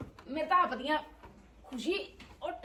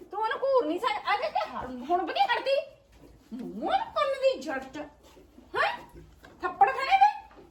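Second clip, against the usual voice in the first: scolding; 9 semitones higher than usual. Real, not acted. High scolding of the calf.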